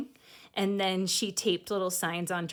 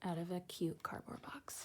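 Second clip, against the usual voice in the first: soft secretive whisper; 8 dB quieter than usual. Acted. Reader sharing a little secret with the kids, whispered. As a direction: let the cardboard box slip out in a whisper.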